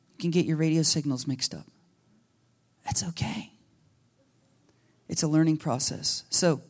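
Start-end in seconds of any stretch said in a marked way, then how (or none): 2.8-3.5 soft whisper; whispered reassurance. Real, not acted. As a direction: breathe it like a comfort to one person. Gentle reassuring aside.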